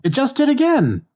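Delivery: high, incredulous complaint; exasperated. Real, not acted. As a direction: squeak it out in disbelief. Hero exasperated at his growing title.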